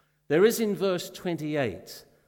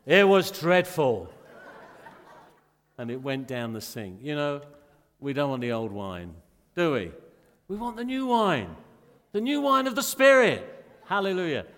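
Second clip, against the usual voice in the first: loud, ringing sermon delivery; fervent pulpit preaching; full-force preaching; 8 dB louder than usual. Acted. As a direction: preach it out to the congregation.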